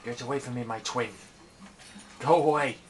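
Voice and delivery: usual speaking voice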